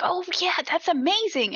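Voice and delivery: high-pitched mock amazement; gushing praise. Older couple reacting to her articles, mock gushing. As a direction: imitate a party guest cooing over someone's news.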